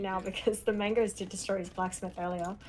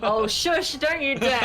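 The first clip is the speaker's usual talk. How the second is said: loud, higher-pitched mock outrage; playful scolding; 5 semitones higher, 8 dB louder, slower than usual. Real, not acted. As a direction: scold your co-caster, half in fun. Playful scolding amid laughter.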